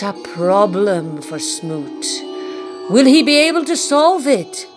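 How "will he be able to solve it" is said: ordinary delivery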